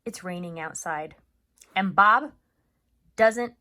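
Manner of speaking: measured storytelling with long pauses; teacher reading a chapter book to third graders; read-aloud narration. A narrator's reading. Teacher reads the novel aloud.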